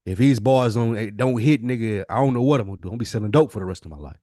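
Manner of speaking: normal talking voice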